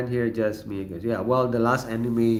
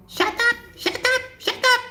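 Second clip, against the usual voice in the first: very high, loud squeaky shout; high squeaky yell; 4 dB louder than usual. Retold or imitated. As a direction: screech it like a sound effect. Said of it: "there's a sound coming in".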